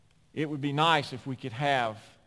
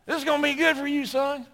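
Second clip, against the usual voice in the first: high, loud, fired-up dad cheering his son on; a proud dad thrilled his boy is enlisting; excited father; 12 semitones higher, 7 dB louder than usual. Retold or imitated. Father cheering son off to military, excited.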